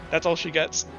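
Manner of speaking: usual speaking voice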